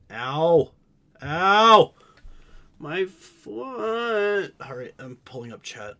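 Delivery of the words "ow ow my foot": loud, high cry of pain; pained yell. Real, not acted. Foot in pain.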